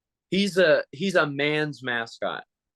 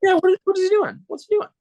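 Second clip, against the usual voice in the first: much higher, louder squealing outrage; mock disbelief; 7 dB louder, faster than usual. Reacting to the mascot smearing his glasses, hammed-up squeal.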